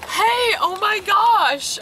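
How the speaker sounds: loud, high, stretched-out greeting; retold shriek of recognition; imitated excited fans. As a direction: call out like a fan spotting a favourite cosplay.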